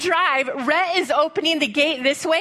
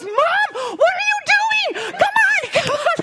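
frantic shout; 13 semitones higher than usual. Retold or imitated. Son yells at mom stuck in the pen, frantic. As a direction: push her to hurry, desperate.

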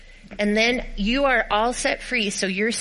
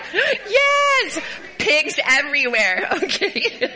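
laughing cheer; 9 semitones higher, slower than usual. Real, not acted. Pigs thrown, kids laughing.

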